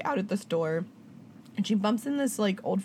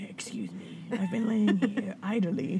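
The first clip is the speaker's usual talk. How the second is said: a slow huffy whisper for the dog; pet's morning voice; 3 dB quieter, slower than usual. Pretend. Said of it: "that's how her morning voice".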